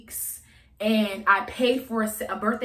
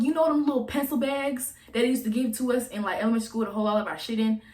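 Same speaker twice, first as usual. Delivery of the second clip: ordinary delivery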